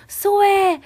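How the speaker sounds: high, loud call from the ship's balcony. Retold or imitated. Crew calling down to her from the balcony, a loud high call. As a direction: wave someone aboard with a shout. People on ship wave her aboard.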